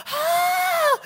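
very high, drawn-out shocked cry; imitated scandalized squeal; mock shocked gasp. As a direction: gasp out a hammed-up shriek.

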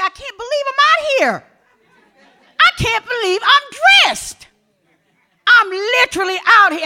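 high, laughing, amazed; thrilled disbelief. Retold or imitated. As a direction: burst out giddy at being out in the rain. Retells her thrilled outburst.

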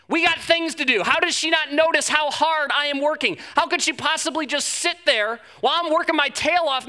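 loud, fast, sarcastic rant about the lazy sister; exasperated complaining sister. Retold or imitated. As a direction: vent at Jesus like a fed-up host. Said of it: "she's complaining about her sister".

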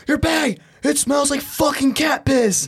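high, indignant mimicry, dragging out the complaint; yelling security guard. Guard telling a traveler off over his bag, high-pitched mock yelling. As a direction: act out an outraged guard's rant. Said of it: "He was getting yelled at".

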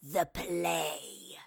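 slow, snarling monster voice; wraith's snarl. A narrator's reading. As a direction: snarl it slowly as a menacing wraith. Wraith answers menacingly.

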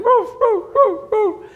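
high loud woofing like a yapping dog; dog barking. Retold or imitated. Preacher barking like the neighbor's dog at night. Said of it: "they're barking".

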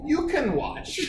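high, singled-out teasing line; teasing taunt. Retold or imitated. Prankster taunting the guy next to her, high teasing voice. Retold taunt to woman's partner.

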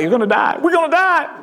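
high-pitched panicked cry; retold scream, pitch leaping up at the end; mock scream. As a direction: imitate someone crying out in doom. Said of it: "Screamed out".

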